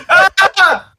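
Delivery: sudden high laughing cry; high laughing yelp. Real, not acted. Laughing outburst.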